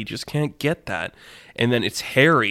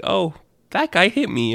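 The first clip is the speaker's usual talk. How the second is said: high, squeaky little cartoon voice; hurt minion voice; 9 semitones higher than usual. Harry tells the other minions who hit him, squeaky minion voice. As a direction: answer in a small, high-pitched minion squeak.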